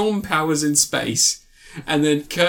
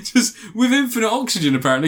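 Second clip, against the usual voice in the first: high, helpless laughing delivery; cracking up; 8 semitones higher than usual. Real, not acted. Film reviewer mocking a space scene, cracking up. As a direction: squeeze the words out through laughter.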